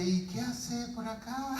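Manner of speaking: high, drawn-out timid question; mock manly nerves. Nervous pastor facing the guitar owner, mock manly voice. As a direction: drag it out, comically unmanly. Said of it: "con esa voz bien varonil".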